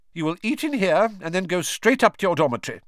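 quick no-nonsense teacher; brisk stern professor. A narrator's reading. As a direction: rattle off orders briskly. McGonagall voiced, brisk.

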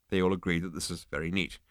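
ordinary delivery, nothing marked

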